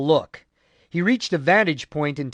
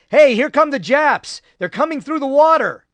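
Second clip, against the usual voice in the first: sharp alarmed shout, pitched higher; warning cry; 10 semitones higher, 6 dB louder than usual. A narrator's reading. Marine shouts warning of enemy approach, loud alarm. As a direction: shout the alarm across the water. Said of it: "a warning cry".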